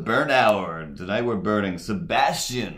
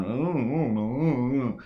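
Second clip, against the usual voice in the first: high, wordless humming mumble; mocking mumble noises; 4 semitones higher, slower than usual. Comedian mocking Bill Cosby's act with no material, mumbled noises. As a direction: mimic a comic's meaningless wavering mumbles. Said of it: "he is just making faces and noises".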